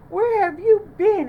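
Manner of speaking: high, loud critter voice; squeaky little porcupine wanting answers; Porcupine asking. A narrator's reading. Porcupine calls out to Bunny at the door, high and loud. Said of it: "asked Porcupine".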